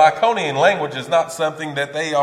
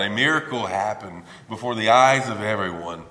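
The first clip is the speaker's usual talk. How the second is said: usual speaking voice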